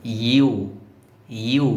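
"ইউ" as an isolated, deliberate syllable; careful repeated "ইউ", a pause between; careful pronunciation demo. Shown as a demonstration. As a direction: show learners exactly how "ইউ" is sounded. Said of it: "এরকম হবে".

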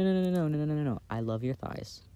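ordinary delivery

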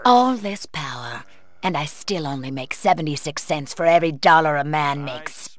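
usual speaking voice

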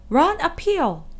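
high sheep call; sheep crying out. A narrator's reading. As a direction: cry it out like a sheep. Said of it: "cried the sheep".

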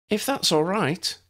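put-on high, slow pleading young voice; pleading girl. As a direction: ask as a hopeful girl, drawn out. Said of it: "Lydia pleaded".

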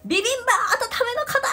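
high, loud call across a busy shop; clerk shouting orders. Retold or imitated. Imitating the clerk calling out a warmed order, loud shout. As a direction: bellow it like a convenience store clerk. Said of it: "店員さんもめっちゃ大きい声で".

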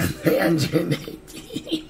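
words squeezed out through high laughter; laughing while reading a number. Real, not acted. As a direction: giggle out the number slowly. Laughing at friend's weight.

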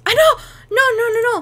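real frantic no no no; panicked cry. Real, not acted. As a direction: blurt it out in sudden panic. Kitten photo alarm.

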